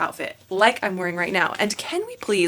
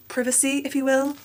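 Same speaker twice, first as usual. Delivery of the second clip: slightly higher-pitched, put-on British accent; bad British accent; 5 semitones higher than usual. Real, not acted. Vlogger slipping into Bridgerton talk, bad British accent. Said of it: "going into my bad British accent".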